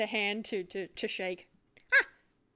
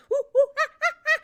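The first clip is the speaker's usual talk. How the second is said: high excited monkey hoots; monkey chatter; 10 dB louder, much faster than usual. Acted. GM voices the monkey jumping out, excited hoots. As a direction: hoot like a happy little monkey.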